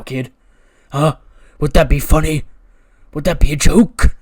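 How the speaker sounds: put-on loud, hard, bullying growl at a kid; menacing threat. Karrion Kross threatening Murr against the wall, loud and menacing.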